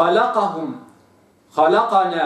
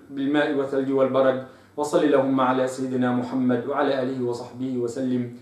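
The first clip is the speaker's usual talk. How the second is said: soft, quiet supplication fading away; soft devout prayer; closing prayer; 4 semitones lower, 3 dB quieter than usual. Real, not acted. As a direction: pray humbly to close the lesson. Closing prayer after the lesson.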